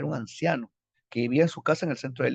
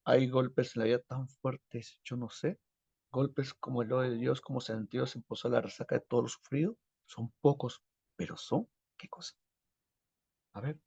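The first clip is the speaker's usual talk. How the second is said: poem reading. Acted. Lee poemas de Vallejo. (recitando el poema en voz baja)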